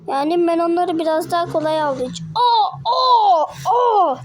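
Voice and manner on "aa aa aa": gloating laugh. Acted. Toy fight, gloating laugh.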